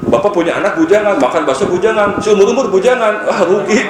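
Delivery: mock teasing. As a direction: rib the congregation playfully about staying single. Preacher mocks a lifelong bachelor.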